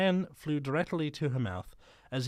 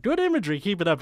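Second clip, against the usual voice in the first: high, loud praising teacher; chirpy teacher praise; 5 semitones higher, 6 dB louder, much faster than usual. Retold or imitated. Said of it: "the teacher's like".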